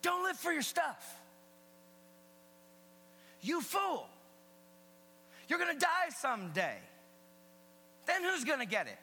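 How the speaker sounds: high, loud preacher's shout; powerful rebuke. Acted. Preacher voicing Jesus rebuking the fool, powerful shout. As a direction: hurl it out as a forceful rebuke. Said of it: "Big power statement".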